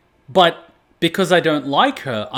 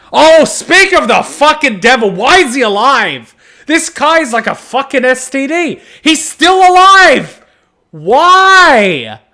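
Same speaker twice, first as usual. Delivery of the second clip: loud, much higher incredulous yelling; outraged shouting; 11 semitones higher, 11 dB louder, slower than usual. Real, not acted. Streamer seeing the hated character alive, loud outraged yelling. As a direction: scream in disbelief at the screen.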